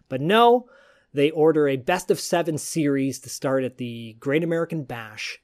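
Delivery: normal talking voice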